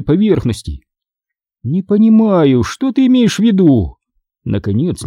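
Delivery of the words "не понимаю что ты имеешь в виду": displeased. A narrator's reading. Teacher answering a pupil about the sponge, displeased. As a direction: show displeasure in a raised pitch. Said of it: "недовольно произнесла она".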